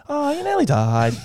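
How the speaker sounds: high, loud, happy imitation; chipper surgeon. Surgeon tells patient of near death, high chipper imitation. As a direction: break terrible news in a cheery high voice. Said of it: "that's exactly how she said it".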